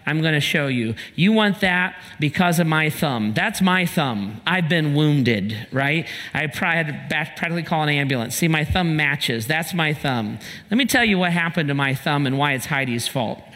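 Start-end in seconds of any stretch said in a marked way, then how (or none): none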